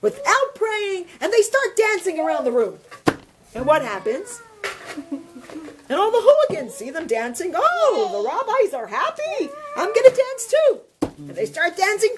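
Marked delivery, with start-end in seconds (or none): 0.0-1.1 higher, mildly excited storyteller's quote; acted delight, pitched up; happy realization. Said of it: "So they get so happy". 7.5-10.8 high, exclaiming mock delight; acted-out excitement, pitched well up; delighted hooligan. Hooligans delighted to see rabbis dancing.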